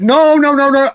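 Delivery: sharp, high, loud forceful denial; aggressive no. Retold or imitated. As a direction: shut it down hard, like a firm rebuke. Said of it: "aggressively or semi-aggressively".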